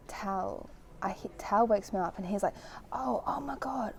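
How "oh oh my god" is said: retold frantic whisper, breathy and hushed; panicked whisper. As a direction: whisper it as if waking someone in alarm. Tal waking her in panic.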